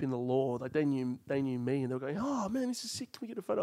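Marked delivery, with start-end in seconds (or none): 2.2-3.6 high, soft gushing fan voice; mimicked fan. Comedian mimicking dudes met in Brisbane, mimicked fan. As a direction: imitate a fan, pitched well up. Mimics fans asking for photo.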